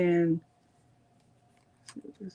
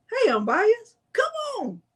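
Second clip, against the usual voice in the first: very high playful squeal; squeaky high greeting; 11 semitones higher than usual. Real, not acted.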